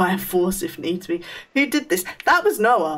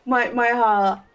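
slow, breathless and louder; shaken; 3 semitones higher, 4 dB louder, slower than usual. Real, not acted. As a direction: still catching your breath. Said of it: "I'm actually gonna cry".

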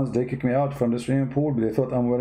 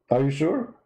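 normal talking voice

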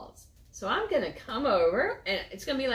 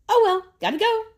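high, loud breezy goodbye; a carefree raccoon racing off; high, quick cheery Adam; cheerful dash; 14 semitones higher, 9 dB louder, faster than usual. Acted. Adam runs off in story.

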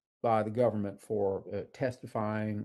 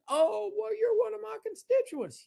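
much higher, hammed-up friendly politician; congressman's reply. Congressman greets a constituent in a high hammed-up voice. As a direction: play the glad-handing congressman high. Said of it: "And he stops and goes".

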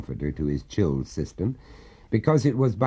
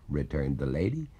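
ordinary delivery

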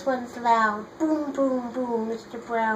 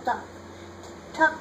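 usual speaking voice

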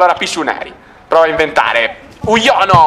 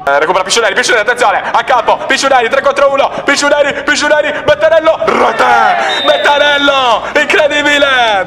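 breathless italian goal commentary; 5 semitones higher than usual. Real, not acted. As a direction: call the goal, stretching the key words. Goal call, live commentary.

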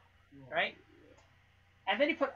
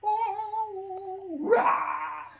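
shouted possessed voice with a laugh; 10 semitones higher, 7 dB louder than usual. Pretend. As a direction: roar like he's possessed. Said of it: "You're being possessed".

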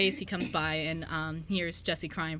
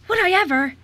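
put-on much higher, much louder thrilled child; gasp, then gush with joy; little sister thrilled at the offer to play; gasping delight; 13 semitones higher, 15 dB louder than usual.

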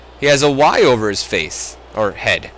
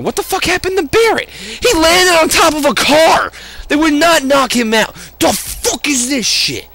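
outraged disbelief; 13 semitones higher than usual. Real, not acted. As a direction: protest the absurd result. Outraged at game physics.

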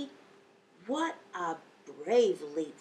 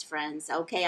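usual speaking voice

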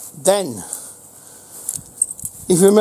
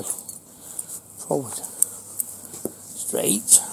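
whispered command; 2 semitones lower, 14 dB quieter, much slower than usual. Real, not acted.

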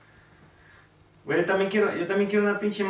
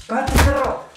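shouted shut up; 7 semitones higher, 4 dB louder than usual. Real, not acted. Yelling at the trainer.

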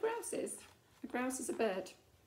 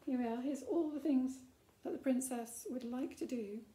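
usual speaking voice

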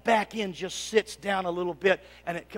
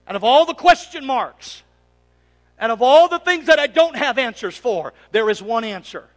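ordinary talk

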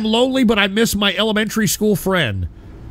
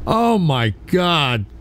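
ordinary delivery

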